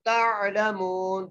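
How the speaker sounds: very slow chanted तालमून with ain joined to ta; Quranic recitation. Shown as a demonstration. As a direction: draw out तालमून syllable by syllable. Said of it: "ता को आइन से मिलाना है".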